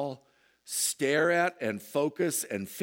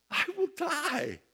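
alarmed cry. Acted. Believer fears dying dragon.

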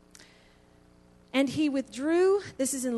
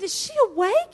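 startled question; 8 semitones higher, 8 dB louder than usual. Retold or imitated.

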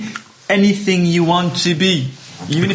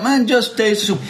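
high-pitched insistence; exasperated diner; 7 semitones higher than usual. Acted. Diner to puzzled waiter in soup joke, high exasperation. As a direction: plead with growing impatience.

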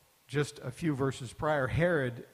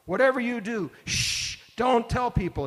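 higher and louder, urgent hush; emphatic shush; 7 semitones higher, 6 dB louder than usual. Retold or imitated. Jesus charging the disciples, an emphatic loud shush. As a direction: hammer home that this must stay secret. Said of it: "It's a very emphatic shush".

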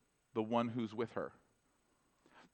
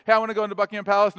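quick, higher-pitched eager request; lightly voiced eager girl; 4 semitones higher, 7 dB louder, much faster than usual. Little girl to the palace guard, lightly acted eagerness. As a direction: blurt it out like a keen youngster, lightly played. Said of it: "this little girl named Kate comes up".

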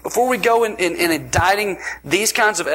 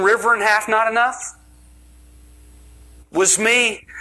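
higher, incredulous questioning God; hammed-up exasperated God asking if miracles weren't enough; 6 semitones higher than usual. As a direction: play God baffled at ungrateful people. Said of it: "God's sitting there going".

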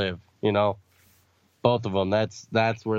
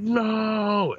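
high mock cry of no; anguished shout; 12 semitones higher than usual. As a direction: yell no in anguish. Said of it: "he's like".

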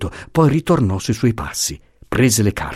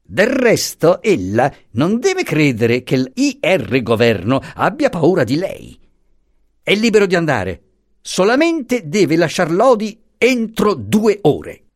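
higher, scornful official; lightly voiced insolent commissioner; 8 semitones higher than usual. A narrator's reading. Commissioner scoffs at the professor's story, mildly scornful. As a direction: dismiss him with contempt.

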